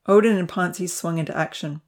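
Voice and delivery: usual speaking voice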